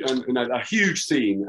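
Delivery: ordinary delivery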